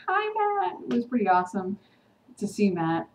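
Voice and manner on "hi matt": high, sing-out hello; fangirl greeting. Retold or imitated. Vlogger reacting to Matt's cameo, delighted high greeting.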